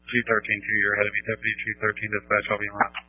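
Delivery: crackly two-way radio; over a scratchy radio. Real, not acted. Units calling over fire radio.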